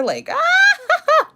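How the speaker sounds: high delighted squeal with a laugh; excited squeal. Real, not acted. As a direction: squeal of pure delight at Natasha. Squealing over contestant's bikini.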